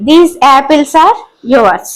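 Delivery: slow dictation. Shown as a demonstration. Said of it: "teacher reads example sentence".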